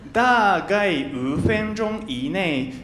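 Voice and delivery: drawn out, word by word; slow and deliberate. Real, not acted. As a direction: spell it out slowly. Slow explanation of meaning.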